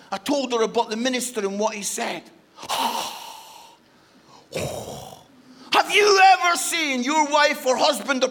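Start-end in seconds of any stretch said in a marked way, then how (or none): 2.6-3.3 long airy mock gasp; shocked gasp. Wife hearing what the minister said, exaggerated shocked gasp. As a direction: suck in breath, aghast.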